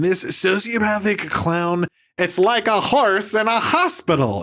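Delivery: high, mock-aggrieved comic rant; Marc Maron. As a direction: mimic a whining comic's complaint. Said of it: "He's like".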